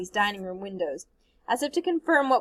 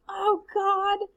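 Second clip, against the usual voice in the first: frightened gasp; 10 semitones higher than usual. Acted. Child watching man stuck in fire.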